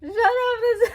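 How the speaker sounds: very high, loud laughing shriek; giddy overload; laughing squeal. Real, not acted. Fan opening the lyric book, shrieking with laughter.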